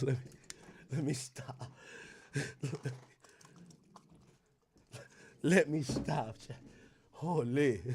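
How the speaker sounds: soft, giggling to himself; can't stop laughing at his own joke; quiet laughing. Real, not acted. Streamer telling chat to calm down, quiet giggles.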